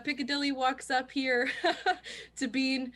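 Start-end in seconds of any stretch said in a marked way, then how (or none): 1.5-2.3 laughing. Real, not acted. GM laughing at player.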